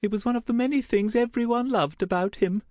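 very high, hurried fond voice; brisk, high-pitched wistfulness; tremulous mother. A narrator's reading. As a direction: remember him fondly in a high voice. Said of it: "gave her a tremulous smile".

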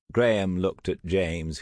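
ordinary delivery, nothing marked

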